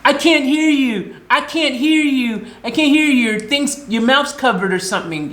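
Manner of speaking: mimicking the rude gas attendant; mocking attendant. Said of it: "And he goes".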